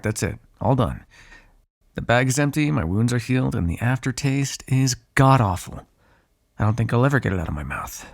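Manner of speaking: ordinary talk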